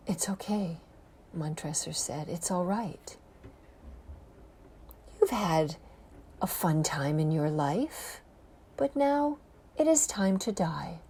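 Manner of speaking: soft, hushed, mock-soothing; calm him like a child before the end; eerie false comfort. Montresor softly soothes his victim before killing him, hushed.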